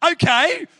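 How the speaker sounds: cheery okay. Retold or imitated. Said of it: "And they went".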